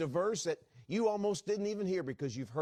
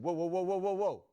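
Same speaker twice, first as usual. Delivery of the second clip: raised-pitch run of whoas; startled protest; 5 semitones higher, 4 dB louder than usual. Retold or imitated. Father stopping his son's offer to buy brake pads, startled. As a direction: cut him off with rapid, higher whoas.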